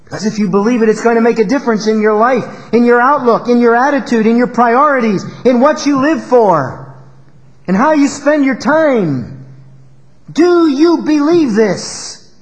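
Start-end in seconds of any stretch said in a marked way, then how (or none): 1.0-9.4 high, loud, rhythmic list; fervent preaching; preaching at full force. Acted. 10.3-12.3 "Do you believe this?" high and very slow, leaning on "Do"; solemn insistent challenge; slow emphatic question. Shown as a demonstration. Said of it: "That's the question we face".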